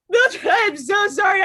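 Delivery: over-the-top tearful sorry; mock sobbing apology. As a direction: wail the apology through fake tears. Acting out crying over an emu.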